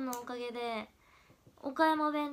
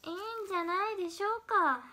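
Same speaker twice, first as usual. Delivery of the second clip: pitched up, mock-imitating delivery; higher mimicry take; 7 semitones higher, faster than usual. Shown as a demonstration. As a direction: lift the whole line higher, playful imitation. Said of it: "モノマネは勝手にやってるだけだよ". (高めの声でふざけたモノマネ)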